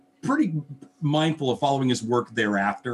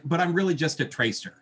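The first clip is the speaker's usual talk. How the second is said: humble protest; 6 semitones higher than usual. Retold or imitated. Host quoting a humble inker on his work, modest protest. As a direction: play down your talent in a higher voice. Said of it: "he's so freaking humble. He's like".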